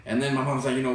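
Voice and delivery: usual speaking voice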